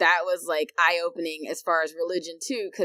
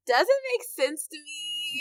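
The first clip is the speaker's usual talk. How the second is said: puzzled skeptic; 10 semitones higher, much slower than usual. Retold or imitated. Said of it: "And I was just like".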